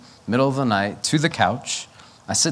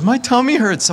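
mock whiny kid; 12 semitones higher, 4 dB louder than usual. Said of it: "He goes".

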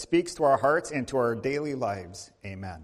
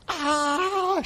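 high pained scream; 3 dB louder than usual. Retold or imitated. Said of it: "she's screaming".

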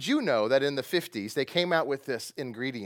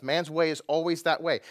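ordinary talk, nothing marked

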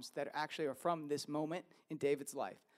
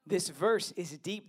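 ordinary talk, nothing marked

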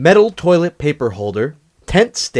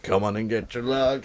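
commercial announcer; 6 dB quieter than usual. Pretend. Ad voice parody.

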